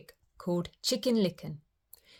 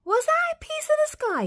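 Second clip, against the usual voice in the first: very high, startled chick voice; squeaking chick; 4 dB louder than usual. A narrator's reading. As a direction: squeak it in alarm. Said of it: "he squeaked".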